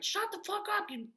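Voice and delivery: high angry shout; screaming. Retold or imitated. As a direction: scream it to shut the voice up. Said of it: "screaming at it".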